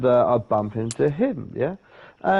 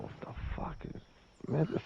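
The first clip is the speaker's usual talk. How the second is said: very soft, almost whispered thought; retold thought under the breath; hushed disbelief; 5 semitones higher, 16 dB quieter, faster than usual. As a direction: murmur it to yourself in disbelief.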